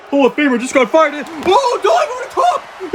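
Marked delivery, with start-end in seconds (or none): 1.3-2.9 high, drawn-out shout; excited commentary. Acted. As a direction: call the sudden dive in astonishment. Commentator calls sudden dive.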